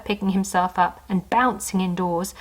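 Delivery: usual speaking voice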